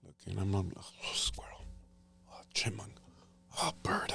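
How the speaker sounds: hushed whisper while hunting; barely voiced, naming each noise; whispered asides in a tree stand.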